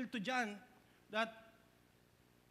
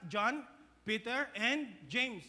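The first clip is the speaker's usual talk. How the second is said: ordinary delivery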